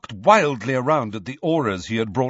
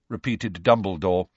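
plain reading voice

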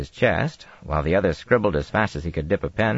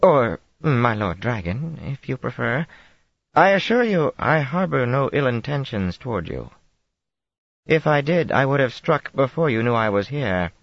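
lightly voiced Tarabana accent, higher; a hint of Tarabana accent; 8 semitones higher than usual. A narrator's reading. Aes Sedai refusing the queen, light Tarabana accent. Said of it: "in a Tarabana accent".

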